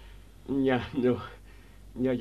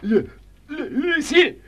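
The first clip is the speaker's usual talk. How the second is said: stammering high, loud scream; terrified cry; 14 semitones higher, 9 dB louder than usual. Acted. Camille waking from a nightmare, screaming Lucille. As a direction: scream awake in terror.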